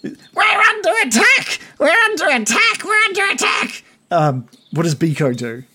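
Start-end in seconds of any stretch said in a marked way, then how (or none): 0.0-3.8 very high-pitched frantic goblin yell; goblin yelling alarm. Acted. Runner warns the goblin king, yelling high. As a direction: screech the alarm as a little goblin.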